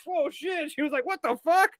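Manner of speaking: high-pitched imitated disbelief; mock astonishment. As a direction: squeak it out as if stunned by the opponent. Acts out Wanda's stunned reaction.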